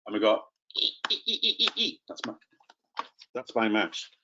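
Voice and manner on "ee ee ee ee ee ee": high-pitched hee-haw ee sounds; donkey bray imitation. Shown as a demonstration. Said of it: "we've got the donkey noises".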